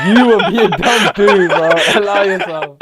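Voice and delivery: loud, high protest through laughter; cracking up while throwing the insult back; laughing retort. Real, not acted. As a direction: laugh it out loudly at a friend. Laughing retort to being called dumb.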